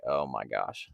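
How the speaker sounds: low, soft "Oh my gosh" under the breath; a sick feeling sinking in; sinking dread. Retold or imitated. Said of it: "I just get a sick feeling".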